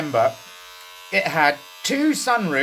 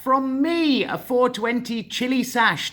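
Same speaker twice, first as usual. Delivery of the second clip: high, slow presenter voice; grand mock-presenter welcome; showman host intro; 6 semitones higher, slower than usual. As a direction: welcome them like a cheesy TV host.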